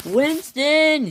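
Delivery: high-pitched, drawn-out cry of alarm; scream. A narrator's reading. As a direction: call the name out high and long. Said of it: "screams the Topham Hatt".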